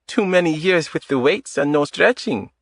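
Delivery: put-on light Eastern European accent. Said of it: "In a light Eastern European accent".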